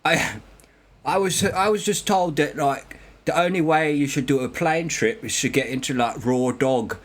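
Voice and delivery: put-on British "innit" accent; English accent. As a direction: explain it as a casual English lad. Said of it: "And the, and the English".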